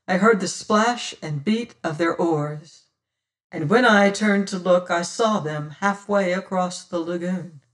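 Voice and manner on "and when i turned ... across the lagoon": plain reading voice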